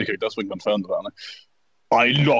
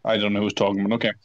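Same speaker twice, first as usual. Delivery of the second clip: normal talking voice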